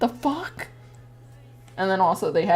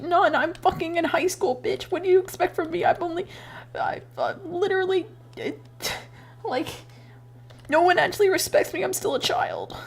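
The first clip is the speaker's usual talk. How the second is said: mock indignant rant; 10 semitones higher than usual. Retold rant at resume askers.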